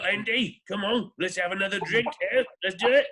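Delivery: mock British pal, eagerly egging him on; over-the-top British accent. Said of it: "he was so over the top".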